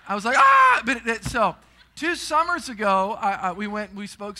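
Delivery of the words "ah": loud, high yell of terror; retold scream. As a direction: scream like the ride just dropped.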